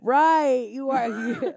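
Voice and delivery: a high, loud burst of agreement; delighted loud "right"; loud excited agreement. Real, not acted. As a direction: shout your agreement. Emphatic agreement, laughing along.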